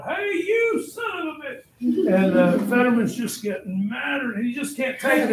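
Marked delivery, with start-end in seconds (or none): 0.0-1.6 high-pitched mock holler; yelled taunt. Warriors taunting soldiers down the hill, high yell. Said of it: "they start yelling down".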